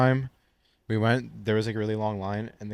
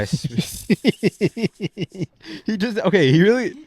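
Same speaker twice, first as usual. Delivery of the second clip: laughing; 10 semitones higher, 8 dB louder, slower than usual. Real, not acted. Laughing while showing the video.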